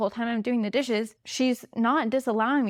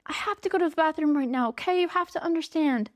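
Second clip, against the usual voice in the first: higher, fast, pleading justification; over-justifying; 5 semitones higher than usual. Retold or imitated. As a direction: plead with the toddler, rushing. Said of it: "I don't need to justify to her".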